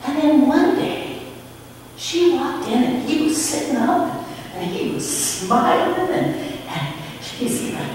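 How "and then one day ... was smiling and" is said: normal talking voice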